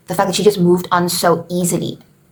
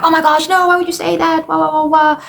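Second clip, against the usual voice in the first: high, whiny mock wailing; mock hysterical queen; 9 semitones higher than usual. Gertrude's pretend fit, in a higher whiny mock wail. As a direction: ham up a fake hysterical fuss. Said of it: "she's not really like".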